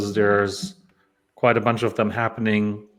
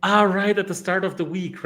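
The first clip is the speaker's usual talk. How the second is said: it suddenly clicks; sudden realization; 8 semitones higher than usual. Real, not acted. Sudden realization mid-chat.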